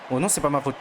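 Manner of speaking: usual speaking voice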